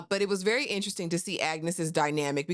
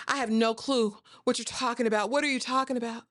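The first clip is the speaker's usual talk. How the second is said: higher-pitched, acted-out denial; feigned innocence; 5 semitones higher than usual. Bertha repeats her denial to her husband, feigned innocence. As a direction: protest cluelessness in a raised pitch.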